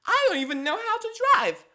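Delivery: very high, loud squeaky cartoon voice; squeaky vagina character. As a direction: play the puppet girlfriend, giddy and shrill-high. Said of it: "Vagina's like".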